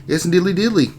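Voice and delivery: sing-song it like an overly friendly neighbour; cheery neighbour voice. Retold or imitated. Streamer reading a chat greeting, chirpy neighbour voice. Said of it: "That's a different, like".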